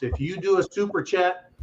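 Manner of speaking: normal talking voice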